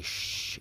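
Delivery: voiceless shh; sneaky shush. Retold or imitated. Teacher shushes as Jameson sneaks out, voiceless. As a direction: hush like a sneaking thief.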